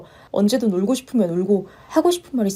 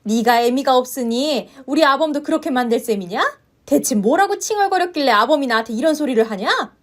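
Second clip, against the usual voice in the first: rising, higher angry scolding; furious mother-in-law; 4 semitones higher than usual. Acted. Mother-in-law berates the wife over her son, furious. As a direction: tear into the daughter-in-law.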